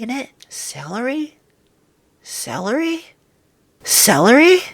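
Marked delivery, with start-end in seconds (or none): 0.5-3.0 incredulous. Real, not acted. Rising disbelief repetition. 3.8-4.6 loud incredulous yell; disbelieving shout at celery; shocked shout. Real, not acted. Climax of disbelief.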